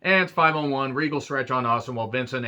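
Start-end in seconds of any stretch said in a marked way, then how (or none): none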